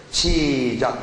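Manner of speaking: ordinary talk, nothing marked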